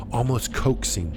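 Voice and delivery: usual speaking voice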